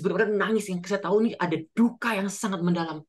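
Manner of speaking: normal talking voice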